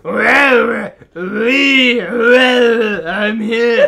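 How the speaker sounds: loud, high mock Chilean accent; wailing Chilean accent, half laughing. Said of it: "doing his Chilean accent".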